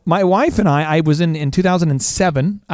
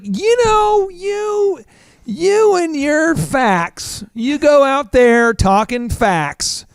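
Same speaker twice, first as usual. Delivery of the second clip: high, drawn-out mock retort; mock indignation; 8 semitones higher, much slower than usual. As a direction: stretch each word in high-pitched mock protest. Mock comeback to wife's pointed question.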